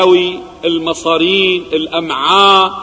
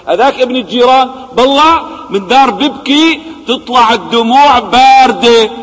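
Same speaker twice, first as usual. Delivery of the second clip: raised, louder burst of relief; relieved outburst; 7 semitones higher, 6 dB louder than usual. Acted. A father hears his son is safe and cries out in relief. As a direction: blurt it out, the weight suddenly gone.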